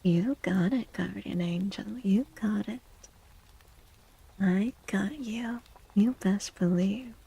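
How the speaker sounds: ordinary talk, nothing marked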